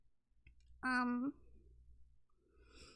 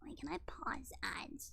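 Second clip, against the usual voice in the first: whispering; 8 dB quieter than usual. Real, not acted. Aside while on a stream.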